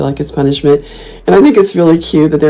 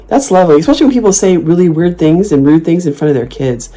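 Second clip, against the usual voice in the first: normal talking voice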